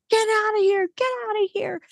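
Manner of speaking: high-pitched frantic yell to get him out; retold scream. Woman woken by a man in her train cabin, shrieking high. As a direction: scream it at the stranger in the dark. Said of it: "I just shrieked and I just started screaming".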